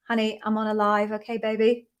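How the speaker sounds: soft, muffled by a covering hand; hand over the phone. Real, not acted. Mid-livestream, daughter on the phone.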